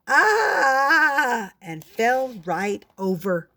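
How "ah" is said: long loud high yell, sliding down; startled yell. A narrator's reading. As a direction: cry out in fright. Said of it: "His father yelled".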